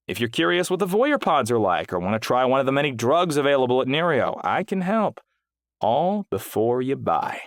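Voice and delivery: upbeat hawker, slowing at the close; salesman. Acted. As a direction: hype the product to a passerby. Salesman's pitch continues.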